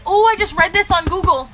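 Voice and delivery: mocking recruits who trust Google, high and naive; mock gullible recruit. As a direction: parrot them like a silly newbie. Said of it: "and they're like".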